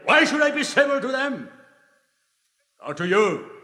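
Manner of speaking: high, loud scornful retort; defiant retort. Acted. Don Juan scorning the Devil's call for civility, loud defiant retort. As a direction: fling the question back in scorn.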